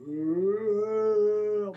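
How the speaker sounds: long, high, loud "ooh"; imitated "ooh". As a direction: re-enact the startled woo. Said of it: "Next thing I was like".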